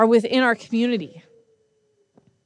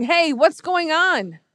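higher, drawn-out call into a crowd; blind beggar calling; 7 semitones higher than usual. Retold or imitated. Blind beggar asking the passing crowd, calling out. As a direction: call out to find out what's happening. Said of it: "this blind beggar is saying".

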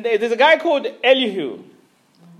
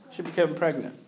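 softer and lower, stress on became; quiet repeat; 5 semitones lower, 8 dB quieter than usual. Real, not acted. Preacher repeating the news to congregation, softly awed. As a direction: let "became" carry it, hushed.

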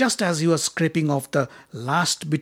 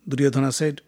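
plain reading voice

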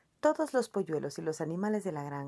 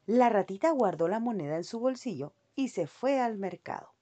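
usual speaking voice

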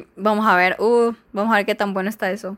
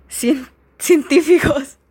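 higher, slow, breaking up on the punchline; laughing; 5 semitones higher, 4 dB louder, much slower than usual. Real, not acted. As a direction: try to get the word out through laughter. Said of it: "aquí ya me he reído". (aguantándose la risa, sin lograrlo)

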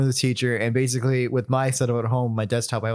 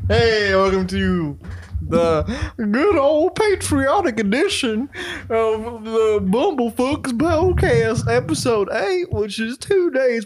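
announcer intro; 8 semitones higher, slower than usual. Acted. Podcast intro announcer read.

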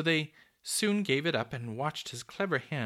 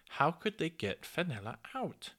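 ordinary delivery, nothing marked

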